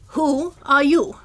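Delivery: ordinary talk, nothing marked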